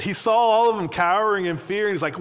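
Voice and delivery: ordinary talk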